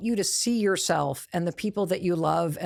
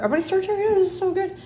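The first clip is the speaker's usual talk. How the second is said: much higher, louder and giddy; giddy self-praise; gushing delight; 12 semitones higher, 5 dB louder, much faster than usual. Real, not acted. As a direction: gush with delight over the words. Gushing over own writing.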